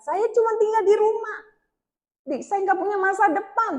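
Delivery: high-pitched plaintive complaint; retold tearful lament. Woman comparing herself to friends before God, high and plaintive. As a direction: lament it high, imitating tearful self-pity. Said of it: "Sedih, nangis, banyak nangis saya".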